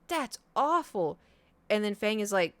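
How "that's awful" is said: slow, high mock gasp of dismay; slow, high hammy that's awful; fake shock. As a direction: overplay innocent horror. [name] fakes shock, slow.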